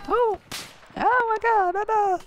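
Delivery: high mock dismay; mock grief wail.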